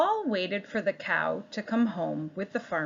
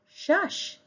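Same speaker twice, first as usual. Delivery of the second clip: cat shushing; 9 semitones higher than usual. Acted. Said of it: "said the cat".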